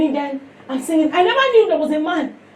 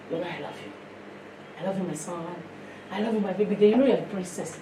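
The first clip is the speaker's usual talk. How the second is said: much softer, low and gentle; tender to her children; 9 semitones lower, 11 dB quieter, faster than usual. Retold or imitated. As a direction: hush it like telling your kids you love them. Mother speaking tenderly to children.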